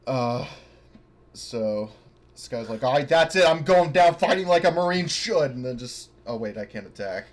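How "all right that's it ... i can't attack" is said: ordinary delivery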